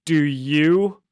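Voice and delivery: mock-menacing villain comeback; villain's retort. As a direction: play the villain's challenge. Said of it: "then the scorpion says".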